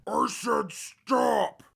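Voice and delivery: losing patience, yell the order; robot yelling again for the ghoul to stop; slow angry shout. Acted. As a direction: shout it slowly, each word hammered.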